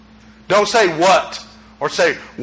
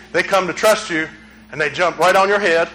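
normal talking voice

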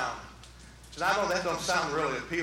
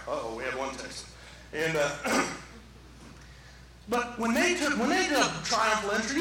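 ordinary delivery

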